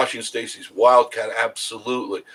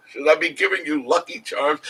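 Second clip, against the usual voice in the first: mock mother; 9 semitones higher, 5 dB louder than usual. Mocking the goth kid's mother.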